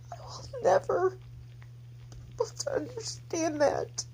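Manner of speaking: high, tight tearful voice; real sobbing grief, very high pitched; crying. Real, not acted. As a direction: squeeze the words out softly through tears. Still in tears after breaking down.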